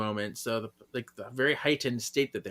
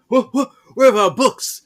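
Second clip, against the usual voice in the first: much higher and louder, startled; hammed-up attackers caught off guard; alarmed thugs; 11 semitones higher, 10 dB louder than usual. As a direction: panic at the missing books. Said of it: "They're like".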